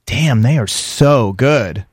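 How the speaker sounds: slow, stretched-out words of admiration; drawn-out admiration. Retold or imitated. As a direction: draw out each word, impressed. Acts out admiring British actors.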